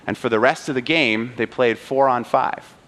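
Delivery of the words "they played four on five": usual speaking voice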